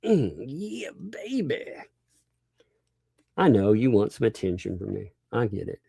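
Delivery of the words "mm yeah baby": mock seductive taunt. Taunting a critic on stream.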